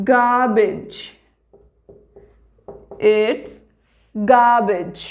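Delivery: garbage said gaa-bij without r; correct garbage. Shown as a demonstration. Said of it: "आर का sound भी नहीं आना चाहिए".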